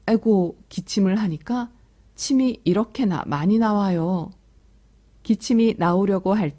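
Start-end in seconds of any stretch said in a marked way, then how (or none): none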